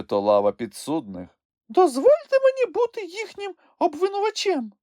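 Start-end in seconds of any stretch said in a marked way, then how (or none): 1.7-4.7 very high squeaky creature voice; high, pushy little creature; insistent Sniff. A narrator's reading. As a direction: insist in a tiny, high-pitched voice. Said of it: "наполягав Чмих".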